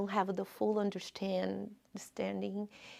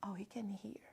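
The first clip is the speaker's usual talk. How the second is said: hushed whisper of realization; 14 dB quieter, faster than usual. Retold or imitated. Child realizing God hears, soft whisper. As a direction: breathe it out in a whisper. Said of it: "I whispered".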